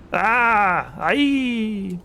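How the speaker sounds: high, loud drawn-out scream. Acted. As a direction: scream as if just shot. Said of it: "Suddenly a scream is heard".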